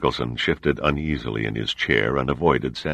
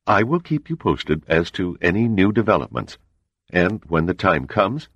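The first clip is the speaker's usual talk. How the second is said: higher, measured voice of a composed spy chief; cool CIA agent; 6 semitones higher than usual. A narrator's reading. CIA man explains the murder to the admiral, composed. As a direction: lay out the facts coolly and evenly.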